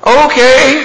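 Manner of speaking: very high, loud, meek little "okay"; man on the bridge caving in, comically meek; meek reply. Retold or imitated. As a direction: give in sheepishly at once. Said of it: "you hear Blair's voice".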